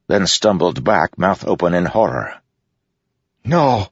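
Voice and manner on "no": high cry of disbelief; horrified cry. A narrator's reading. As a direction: recoil in horror, pitch raised. Assassin recoils in horror.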